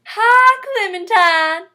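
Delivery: high, drawn-out Southern call, "Hi" as "Hah"; Southern accent. Shown as a demonstration. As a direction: sing out a big Southern hello. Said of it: "The I sound is pronounced ah".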